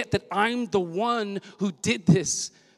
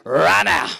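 Right now, punched louder with attitude; 6 dB louder than usual. Shown as a demonstration. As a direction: say "Right now" like you mean it, loud and sure. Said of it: "You gotta say it with some, with some attitude".

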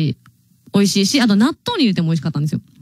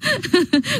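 high rising giggle; 10 semitones higher than usual. Real, not acted. Surprised by a passerby.

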